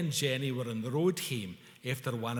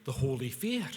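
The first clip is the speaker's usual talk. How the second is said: usual speaking voice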